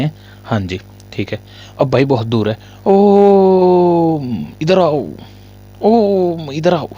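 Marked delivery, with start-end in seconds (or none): 2.9-6.9 long high call across a distance; calling out loudly. Shown as a demonstration. Teacher calling a student across the room, loud and high. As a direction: call Om from far away. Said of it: "कैसे बोलेंगे?".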